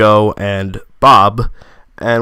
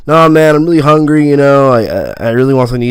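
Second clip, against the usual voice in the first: higher and louder, pleading he's starving; insistent hungry plea; 3 semitones higher, 3 dB louder, faster than usual. Retold or imitated. Friend questions the stop, he insists loudly he's hungry. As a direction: push back eagerly, louder and higher.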